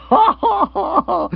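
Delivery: high laughing burst. Acted. Rory laughing at his own quip, loudly. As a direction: crack up at your own joke.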